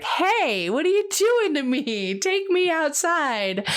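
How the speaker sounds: high-pitched mock complaint from the soul; pleading soul. Her soul begging to go outside, high-pitched protest. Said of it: "My soul's like".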